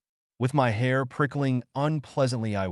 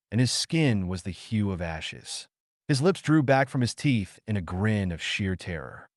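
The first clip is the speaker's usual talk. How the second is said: ordinary delivery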